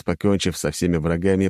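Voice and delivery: usual speaking voice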